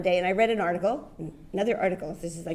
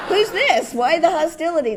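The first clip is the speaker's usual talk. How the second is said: startled indignation; 8 semitones higher, 5 dB louder than usual. Retold or imitated. Stunned by a rude caller.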